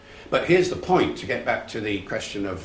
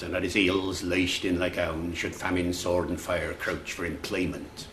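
original Shakespearean accent; 3 semitones lower than usual. Shown as a demonstration. Lecturer demonstrates the ancestor accent to an audience, original Shakespearean accent. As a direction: recite verse in an Early Modern English accent. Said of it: "you hear that accent".

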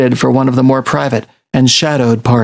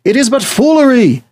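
shouting; 11 semitones higher than usual. A narrator's reading. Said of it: "Hamlet shouted at them".

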